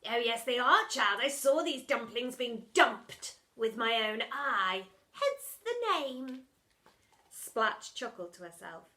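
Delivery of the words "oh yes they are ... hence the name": gloating villain. Acted. Splat gloating.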